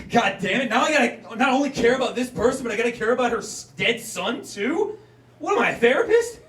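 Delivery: high, loud, put-upon complaining; exasperated rant. Retold or imitated.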